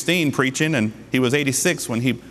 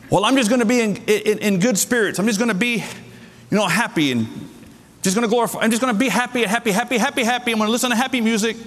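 higher, sing-song parody of a carefree churchgoer; higher mocking singsong, fast at the end; mock naive believer; 7 semitones higher, faster than usual. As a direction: poke fun at someone who just wants to feel happy.

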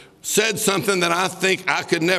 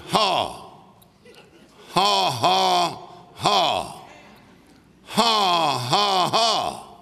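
deliberate, spaced-out "ha" laugh; forced laugh; much slower than usual. Retold or imitated. Preacher retelling how a sick man made himself laugh, forced laugh. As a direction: laugh mechanically, one slow ha at a time. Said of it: "I had to force myself to laugh".

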